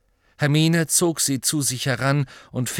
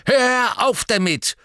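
loud, high, slow snap; snapping; 13 semitones higher, 7 dB louder, slower than usual. A narrator's reading. Harry snapping at Creature, loud and high. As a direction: snap at the elf to stop. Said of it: "fuhr ihn Harry an".

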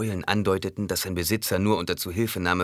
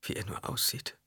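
hushed, much softer aside; inner thought; 2 semitones higher, 9 dB quieter than usual. A narrator's reading. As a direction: think it to yourself, barely voiced. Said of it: "dachte Alia". (leiser innerer Gedanke)

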